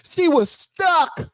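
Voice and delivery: high, drawn-out crowing; amazed, high-pitched exclamation; gleeful disbelief. Real, not acted. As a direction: stretch it out high, reveling in her freeze. Repeats gleeful reaction to frozen answer.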